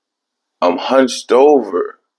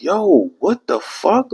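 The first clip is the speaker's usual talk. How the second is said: high, softer disbelief; stunned and lost; mock shocked disbelief; baffled; 12 semitones higher, 3 dB quieter, slower than usual. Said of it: "So I'm like".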